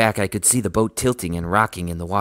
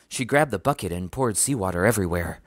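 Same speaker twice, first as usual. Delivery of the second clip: ordinary delivery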